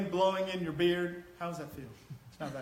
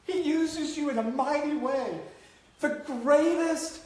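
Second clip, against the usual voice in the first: loud, high preaching cry; fiery sermon climax; preaching at full force; 8 semitones higher, 7 dB louder than usual. Acted. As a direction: preach it out to the back row. Preaching climax about Moses.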